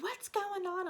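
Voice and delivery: high-pitched retold yell; mock yelling. Neighbor's girlfriend butting into the stomping row, mock yelling. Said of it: "she started yelling at me".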